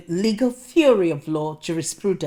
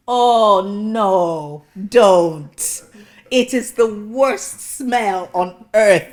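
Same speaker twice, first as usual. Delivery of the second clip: loud, slow revulsion; disgust; 2 semitones higher, 8 dB louder, much slower than usual. Real, not acted. Speaker to audience about cheese and onion crisps, loud disgust. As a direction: recoil from the memory. Recalling crisp factory smell.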